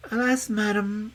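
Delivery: high, sad princess voice; sorrowful princess. A narrator's reading. Princess explaining her clothes to the old woman, sadly.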